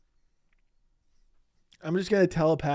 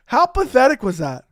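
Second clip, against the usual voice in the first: higher and louder, incredulous; loud disbelief; 7 semitones higher, 7 dB louder than usual. Real, not acted. Streamer asking viewers about the clip, loud and incredulous. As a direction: throw the question out in real disbelief.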